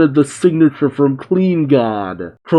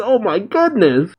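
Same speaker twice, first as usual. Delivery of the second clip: higher-pitched exclamation at normal volume; mock shout; 7 semitones higher than usual. Doctor Hedo reacts to the monster's roar, mild mock shout. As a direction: imitate a startled shout, held back. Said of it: "Doctor Hedo shouts".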